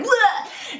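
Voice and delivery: high-pitched imitation retch; barfing noise. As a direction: mimic a sick dad throwing up. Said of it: "He's barfing".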